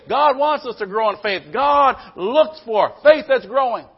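loud, high pulpit exhortation; full-force preaching. Acted. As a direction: lift the room, preach it loud.

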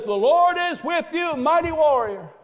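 a hint of a grand heavenly greeting; divine proclamation. God hails hiding Gideon.

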